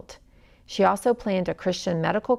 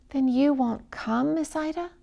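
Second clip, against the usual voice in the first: sorrowful question; 7 semitones higher than usual. Acted. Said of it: "asked sorrowfully".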